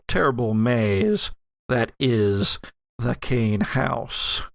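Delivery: ordinary delivery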